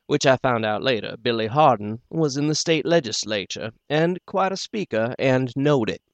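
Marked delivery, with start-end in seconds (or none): none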